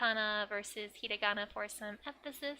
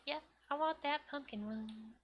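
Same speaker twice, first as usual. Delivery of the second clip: put-on higher, softer voice for a girl's answer; lightly voiced girl placing an order; customer's agreeing reply; 4 semitones higher, 5 dB quieter than usual. Ena answering the shopkeeper about cake, in a higher, soft voice.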